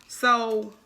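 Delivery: usual speaking voice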